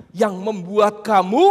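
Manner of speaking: normal talking voice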